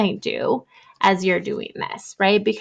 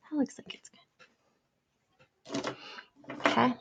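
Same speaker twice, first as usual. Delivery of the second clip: whispered aside; 3 semitones higher, 11 dB quieter, faster than usual. Real, not acted. Teacher checking work to herself.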